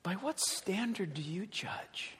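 very soft, unvoiced whisper; hushed, breath-only question; God whispering. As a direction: whisper it as God searching one conscience. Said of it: "hear God whisper to you".

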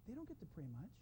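very soft, hushed imitation of a little boy; cute child confiding. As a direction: murmur it like a small boy's secret. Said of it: "It was so cute how he said it".

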